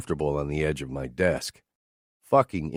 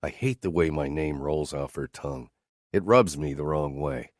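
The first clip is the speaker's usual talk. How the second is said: usual speaking voice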